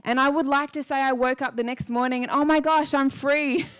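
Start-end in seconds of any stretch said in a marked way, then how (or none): 2.3-3.7 higher, louder pretend jubilation; mock elation. Speaker imagining waking up healed next morning, mock elated. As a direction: gush as if suddenly free, then laugh it off.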